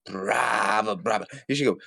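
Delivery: normal talking voice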